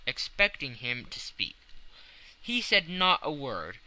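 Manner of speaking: ordinary delivery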